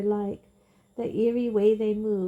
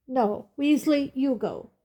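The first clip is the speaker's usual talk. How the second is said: a louder, higher curt order; barked; 5 semitones higher, 5 dB louder than usual. A narrator's reading. Fudge barking an order at his assistant Percy. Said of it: "Fudge barked at Percy".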